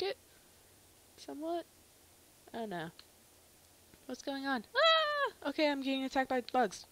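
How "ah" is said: high, loud yelp; short high cry of alarm; startled scream. Real, not acted. Player attacked by bugs, startled high scream.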